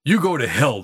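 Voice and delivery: mildly fierce retort. A narrator's reading. Boy rebuffing the tramp's offered coat, mildly fierce. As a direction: snub him with a touch of fierceness. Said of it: "the boy said fiercely".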